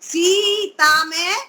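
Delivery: सीता said slowly with a long सी; long vowel shown. Shown as a demonstration. Teacher demonstrating the long vowel slowly. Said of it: "दीर्घ ई की मात्रा है".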